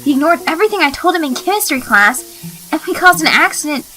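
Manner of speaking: exasperated rant. Acted. Marinette ranting to Tikki about her awful day, exasperated. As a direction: pile up the complaints till it breaks.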